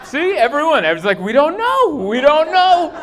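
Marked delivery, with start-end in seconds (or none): 1.2-2.9 mock clueless whine.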